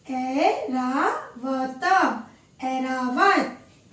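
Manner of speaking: Airavat drawn out very slowly each time; a teacher slowly repeating Airavat for the class; slow teaching read. Shown as a demonstration. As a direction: pronounce Airavat slowly, every syllable clear. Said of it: "नाम है ऐरावत".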